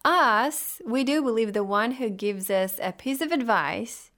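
ordinary talk

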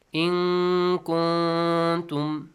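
very slow chant, both noons in "In kuntum" held as nasal hums; hidden noon. Shown as a demonstration. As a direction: hide each n of "In kuntum" in a long hum. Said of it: "hidden sound".

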